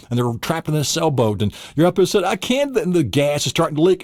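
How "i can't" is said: higher, helpless cry; strained mock despair. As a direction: give up in mock panic. Trying to free trapped people.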